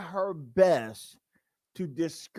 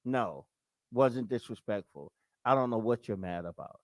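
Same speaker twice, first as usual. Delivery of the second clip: put-on low, softer soothing girlfriend; unbothered calm voice, low and soft; calm woman; 7 semitones lower, 5 dB quieter than usual. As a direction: settle him down, lower and softer. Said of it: "And she's like".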